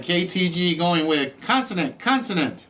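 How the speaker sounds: ordinary talk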